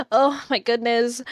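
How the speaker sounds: ordinary talk, nothing marked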